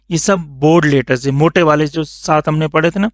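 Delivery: ordinary delivery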